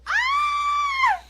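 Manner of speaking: a sudden very high shrieking "Ah!"; excited shriek. Real, not acted. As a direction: let out a piercing squeal of excitement. Excited yelp after stat.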